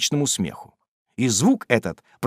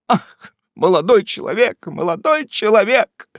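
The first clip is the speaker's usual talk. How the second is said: tearful; 9 semitones higher, 5 dB louder than usual. A narrator's reading. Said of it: "залилась горькими слезами".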